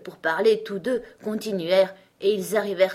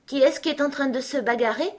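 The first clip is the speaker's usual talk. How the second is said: higher-pitched, cautious asking; tentative question; 7 semitones higher than usual. A narrator's reading. Alice dares to ask the king, tentative. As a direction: venture the question. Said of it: "se risqua-t-elle à demander".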